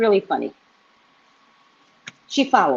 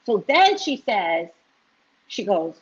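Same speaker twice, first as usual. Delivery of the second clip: ordinary talk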